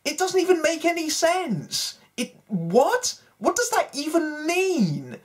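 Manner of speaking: can't believe the pun makes no sense; incredulous. Real, not acted. Reviewer baffled by the horse pun, high incredulous.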